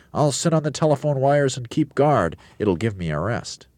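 high bird character voice; light high bird. A narrator's reading. Roly-poly bird voice.

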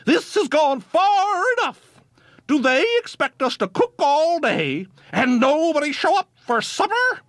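high, fed-up wives' complaint; shrill it out as the fed-up farm wives; indignant wives. A narrator's reading. Wives grumbling about supper, high and indignant.

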